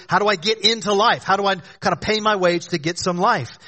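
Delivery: ordinary talk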